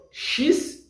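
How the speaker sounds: whispered "chis". Shown as a demonstration. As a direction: breathe "chis" unvoiced.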